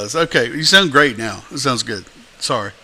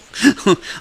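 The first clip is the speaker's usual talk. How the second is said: laugh; 8 semitones higher than usual. Real, not acted. Amused by muffled mic sound.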